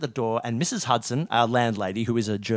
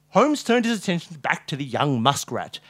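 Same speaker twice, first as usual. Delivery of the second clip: usual speaking voice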